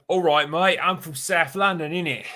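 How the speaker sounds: high, loud South London caricature; South London accent. Acted. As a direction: put on an over-the-top South London accent. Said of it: "they all kinda talk like that".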